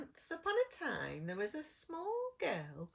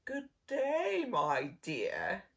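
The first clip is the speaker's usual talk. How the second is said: wolf growl; 8 dB louder, much slower than usual. Acted. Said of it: "growled the wolf".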